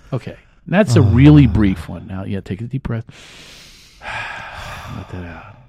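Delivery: ordinary talk, nothing marked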